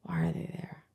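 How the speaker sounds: near-silent whisper; puzzled question under the breath; hushed aside. Real, not acted. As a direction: whisper it almost to yourself. Baffled aside about the eggs.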